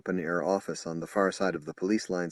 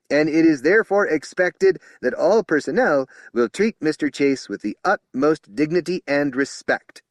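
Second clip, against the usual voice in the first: higher and louder formal warning; stern announcement; 7 semitones higher, 7 dB louder than usual. Acted. Spokesman formally demanding respect for Chase, loud. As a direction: lay down the law formally. Official introduction to officers.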